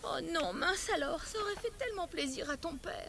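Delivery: softly disappointed. Acted. Mother finds the necklace gone, softly sad. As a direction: let the hope drain out quietly.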